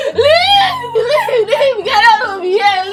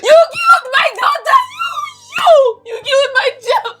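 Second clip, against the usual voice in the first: hysterical crying shouts, higher and louder; grieving mother screaming at her husband while crying; sobbing screams; 5 semitones higher, 5 dB louder than usual. Acted. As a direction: scream blame through sobs.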